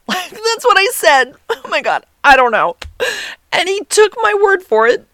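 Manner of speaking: high, loud words squeezed through laughter; laughing. Real, not acted. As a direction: crack up in disbelief at your own answer. Laughing at her own silly answer.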